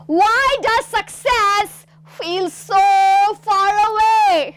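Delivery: high, loud, very slow, anguished; with disgust. Shown as a demonstration. Teacher demonstrating the line with a disgusted face, loud and slow. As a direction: spit out the line in despair. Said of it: "disgust expression होना चाहिए".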